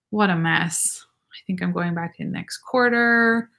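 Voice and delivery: hushed whisper. Real, not acted. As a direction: drop to a breathy whisper. Reading comment, whispering aside.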